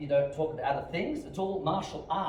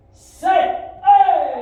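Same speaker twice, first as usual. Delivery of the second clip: high, explosive counting yell; drill command shout; 19 dB louder than usual. Real, not acted. Instructor calling kicks for students, shouting. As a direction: bellow the count to drive the class.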